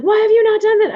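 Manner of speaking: high, loud imitated reproach; mock exasperated client. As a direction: pitch it way up, scolding as the client. Voicing client's demanding phone call.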